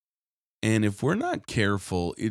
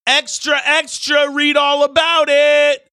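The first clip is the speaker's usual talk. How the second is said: much higher, louder street hawker call; paperboy yell; 12 dB louder than usual. Retold or imitated. Preacher imitates paper boys shouting headlines, loud and high. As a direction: shout it like a newsboy on the corner. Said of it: "they would yell something like".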